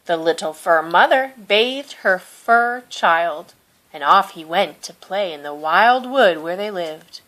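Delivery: ordinary delivery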